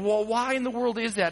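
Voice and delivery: normal talking voice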